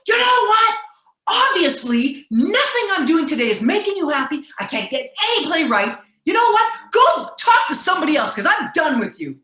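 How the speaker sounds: high, loud exasperated outburst; snapping back. Retold or imitated. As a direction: finally lose it and tell him off. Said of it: "I snapped".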